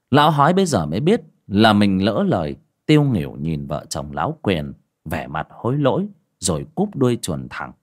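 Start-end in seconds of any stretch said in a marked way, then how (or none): none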